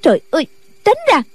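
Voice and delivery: high, rapid order; exasperated shout. A narrator's reading. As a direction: snap at him to move, pitched high and quick. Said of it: "rồi quát lên".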